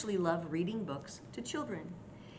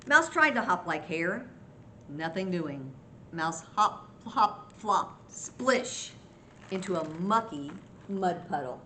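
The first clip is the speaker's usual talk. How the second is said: punchy read-aloud, bouncing on the action words; story narration; 3 dB louder than usual. A narrator's reading. Grandma tells children of Mouse's tumble, lively storytelling. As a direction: make each hop and splash land for young listeners. Reads a picture book to children.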